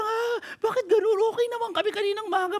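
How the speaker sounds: high, quick voice of a confused worker; puzzled complaint. Retold or imitated. Worker wondering why the boss is angry, high and puzzled. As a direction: play the bewildered employee whose boss turned angry.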